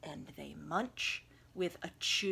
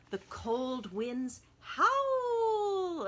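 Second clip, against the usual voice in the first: higher, louder drawn-out howling voice; spooky howl; 9 semitones higher, 6 dB louder, much slower than usual. A narrator's reading. Narrator voicing the howling winter wind. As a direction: let the wind howl through the words.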